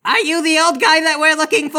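high, loud shouted question; shouting down at the pinned man; excited yell. Acted. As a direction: yell it mid-tackle. Tackling a congressman, yelling.

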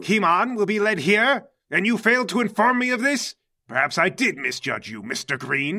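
loud, high outrage dropping to a menacing reproach; incensed villain boss. Acted. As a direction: confront an underling who hid bad news. Mercury learns of withheld danger.

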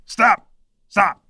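mild mock call of stop; mother halting her son in the market. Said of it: "and your mom goes".

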